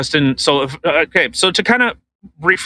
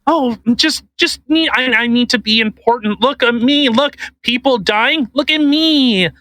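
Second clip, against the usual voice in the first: high, whiny mock celebrity begging for attention; mocking Ben Stiller; 8 semitones higher than usual. Podcast host mocking Ben Stiller, high whiny self-important voice. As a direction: sneer it like a vain star craving cameras.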